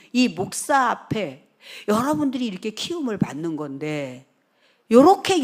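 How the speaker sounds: loud, insistent sermon delivery; forceful preaching. Real, not acted. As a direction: drive it home loudly to the congregation.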